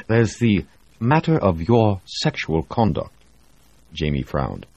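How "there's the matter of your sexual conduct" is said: "There's the matter of your sexual conduct" said formally, falling at the end; a stern doctor's official tone; mock serious. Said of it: "put on a more serious professional face".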